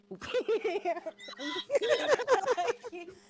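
high, loud burst of laughter; laughing fit. Real, not acted. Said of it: "I'm literally dying".

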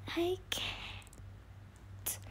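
a barely audible whisper of defeat; faint whisper. Real, not acted. Gamer giving up on the glitch, faint whisper. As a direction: let it fade to a faint whisper.

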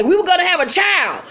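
high-pitched, heated accusation; mock aggrieved outburst. Retold quarrel, anguished accusation.

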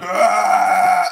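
loud bear-like roar; party guy's roaring yell. Retold or imitated. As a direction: let out a big drunken bear yell. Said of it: "big bear yell".

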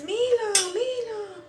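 mom calling her son from afar, held back; imitated calling out. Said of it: "te escucho a ti gritándome". (llamado cantadito y agudo, sin gritar)

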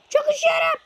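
hammed-up shivering squeak; squeaky freezing voice. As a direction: squeal 'Çok üşüyorum' like a frozen cartoon character. Character frozen in game.

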